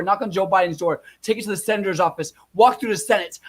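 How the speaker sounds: usual speaking voice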